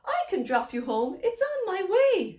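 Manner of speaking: storybook call, lifting high at the end. Acted. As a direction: call it out cheerily to someone passing. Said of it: "a familiar voice call out".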